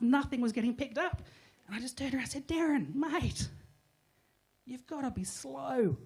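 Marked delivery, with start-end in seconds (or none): 2.5-6.0 slow, drawn-out instruction. Retold or imitated. Telling Darren to scan slowly, drawn out. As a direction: spell it out slowly.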